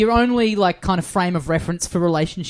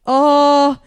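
high, louder stalling "oh"; awkward reluctance; 8 semitones higher, 6 dB louder than usual. Retold or imitated. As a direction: stall uneasily before confessing. Retold reluctant confession about the duck.

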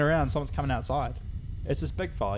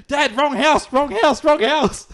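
loud, high-pitched retold shout; re-enacted frantic shout, repeated; panicked calling out; 13 semitones higher, 11 dB louder, much slower than usual. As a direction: yell urgently after dad down the road. Yelling to dad while running away.

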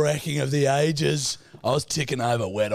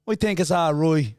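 louder, higher Irish brogue; mock Irish accent; 5 semitones higher, 4 dB louder than usual. Answering the French act with mock Irish accent. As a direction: put on a broad Irish accent. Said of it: "You kinda look Irish".

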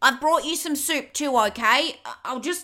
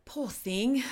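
pitying whisper; 2 semitones lower, 7 dB quieter than usual. Acted. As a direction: whisper it aside with pity. Pitying aside.

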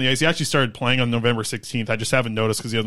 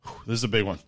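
out of breath; 3 semitones lower, 6 dB quieter, faster than usual. Real, not acted. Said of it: "let me catch my breath".

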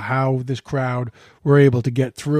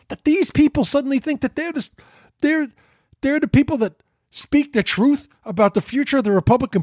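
much higher, rising indignation ending in a sharp "shut the fuck up"; real rising outrage at the Trump sons; exasperated rant; 9 semitones higher than usual. Real, not acted. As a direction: build from disbelief to a dismissive snap.

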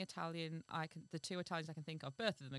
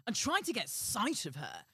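higher, louder royal order; king's voice; 4 semitones higher, 5 dB louder than usual. A narrator's reading. King ordering servant to see her, emphatic royal voice. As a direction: command it with grand emphasis. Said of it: "said His Majesty".

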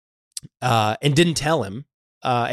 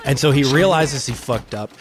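usual speaking voice

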